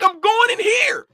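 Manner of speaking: high-pitched, heated retold protest; defiant insistence. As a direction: re-enact digging your heels in, pitch jumping up. Retold argument with the official.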